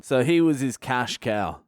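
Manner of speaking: ordinary delivery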